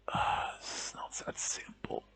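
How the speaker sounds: slow faint whisper; slow dying whisper, "it's not that simple"; weak whisper. A narrator's reading. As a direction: say it slowly, barely whispering. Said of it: "words didn't come out strong enough".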